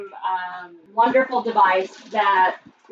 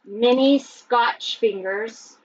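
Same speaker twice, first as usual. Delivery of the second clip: ordinary delivery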